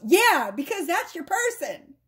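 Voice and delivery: lightly acted spirit, pleading in exasperation; exasperated spirit. Spirit answering someone who resists their person, exasperated. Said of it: "And Spirit goes".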